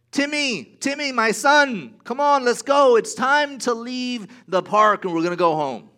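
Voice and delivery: loud, high parent calling across the park. Pretend. Parent calling loudly to a child across the park. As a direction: call your child home from far off.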